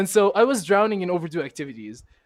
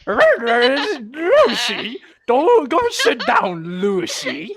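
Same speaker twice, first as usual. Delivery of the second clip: hammed-up barking-dog teacher, high and loud; 7 semitones higher, 8 dB louder, much slower than usual. Friend mocking a teacher as barking dog, hammed-up. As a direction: bark the words like an aggressive dog. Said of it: "Dog barking, just like".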